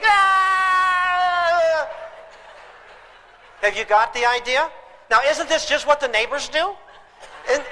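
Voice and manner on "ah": high furious scream; dad screaming. Retold or imitated. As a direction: scream like a dad at breaking point. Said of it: "Dad is screaming".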